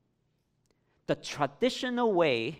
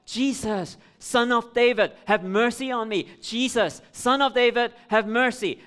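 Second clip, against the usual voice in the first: loud desperate plea, raised pitch; crying out loud; 7 semitones higher, 8 dB louder than usual. Acted. Blind man by the roadside crying out to Jesus, loud desperate plea. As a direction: beg at the top of your lungs. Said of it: "he cried out".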